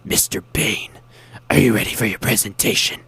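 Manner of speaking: rough unvoiced put-on hero voice; Batman. Friend as hero in radio commercial, growled Batman imitation. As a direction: imitate a growled superhero question. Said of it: "He was Batman".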